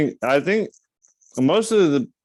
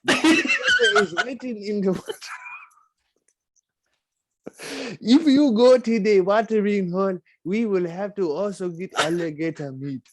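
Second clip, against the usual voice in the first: a lightly put-on voice, much higher, with laughs breaking through; 9 semitones higher than usual. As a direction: play the solemn hunter warning his tribe, half laughing. Voicing tribesman hunter, mocking.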